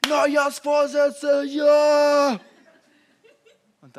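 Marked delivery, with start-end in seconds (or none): 0.1-2.4 shouted, much higher and louder; hammed-up New Year's resolve; mock enthusiasm. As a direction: burst out like a fired-up resolution.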